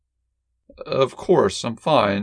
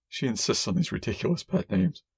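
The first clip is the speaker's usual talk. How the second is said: ordinary delivery, nothing marked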